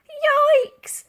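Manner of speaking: high, loud yelp of alarm; caught-out lady yelping; startled exclaim. A narrator's reading. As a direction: jump out of your skin. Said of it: "exclaimed Dotty".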